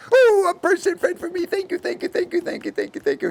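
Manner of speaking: put-on high-pitched gushing child voice; overjoyed little girl. Lonely girl thanking her new friend, high and gushing. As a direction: squeal it high, thanks tumbling out.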